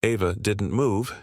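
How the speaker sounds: ordinary delivery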